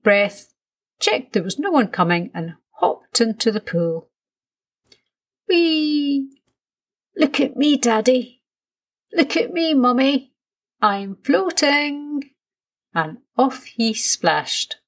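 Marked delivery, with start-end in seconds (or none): 5.5-12.3 put-on high-pitched, gleeful little boy; excited child. A narrator's reading. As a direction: squeal it out while splashing in the pool. Child jumps into the pool.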